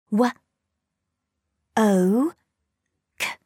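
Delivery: W, O, K as separate sounds; sounding out words. Shown as a demonstration. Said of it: "Blend the sounds".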